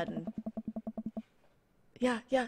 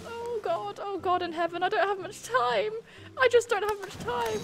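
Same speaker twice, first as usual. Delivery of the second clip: high pleading panic; 10 semitones higher than usual. Real, not acted. Pleading panic, raised pitch.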